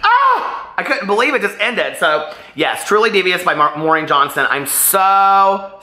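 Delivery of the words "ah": sudden high shriek of shock; retold shriek of disbelief, very high; shocked scream. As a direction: yelp at a cliffhanger ending. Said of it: "and I was like".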